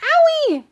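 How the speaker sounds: high, loud cry of pain; loud, high mock ouch; owie. A narrator's reading. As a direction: yelp it like a stepped-on foot. Mayor's foot stepped on.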